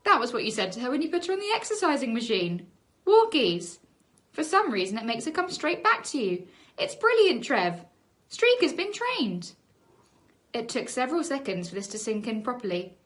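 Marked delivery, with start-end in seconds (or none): 3.0-3.8 high, loud call. Retold or imitated. Tina showing the dog call to Trevor. 6.8-9.5 high, loud thrilled cry; excited. Acted. Tina thrilled telling Trevor the news.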